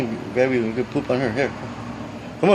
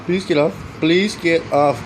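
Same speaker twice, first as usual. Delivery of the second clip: pleading; 5 semitones higher, 4 dB louder, slower than usual. Real, not acted. Begging bird to get off.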